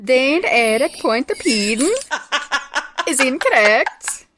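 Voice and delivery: high, slow, hammed-up announcer; mock quiz host. Host rejects the guest's guess, mock announcer. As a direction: rule on the answer with put-on grandeur.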